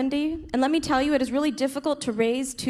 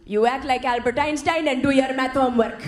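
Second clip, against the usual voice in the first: stern Indian dad. Retold or imitated. Dad rejecting acting.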